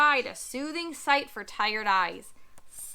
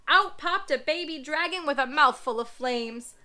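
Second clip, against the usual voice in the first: plain reading voice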